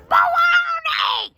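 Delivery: high, long-drawn holler; shouted deli order. Retold or imitated. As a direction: imitate a customer yelling to be heard. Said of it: "nobody can hear each other".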